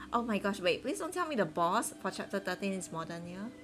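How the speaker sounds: ordinary talk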